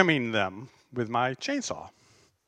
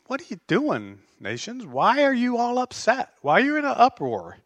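incredulous; 6 semitones higher than usual. Retold or imitated. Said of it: "kind of in an incredulous manner".

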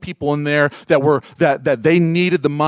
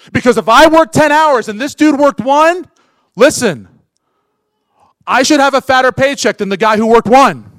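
ordinary talk

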